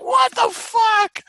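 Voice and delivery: very high, slow shocked shriek; shocked yell. Retold or imitated. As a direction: shriek it out in disbelief. Team reacts to barf.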